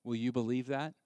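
usual speaking voice

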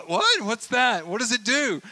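very high, loud baffled squeak; confused pilot. Retold or imitated. Pilot questions tower, very high and alarmed. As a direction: leap up in bewildered alarm.

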